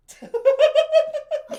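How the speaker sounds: high, loud burst of laughter; shrieking laugh. Real, not acted. Player laughing at dropping silence in the hole, high and loud. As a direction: cackle at the silly safety plan.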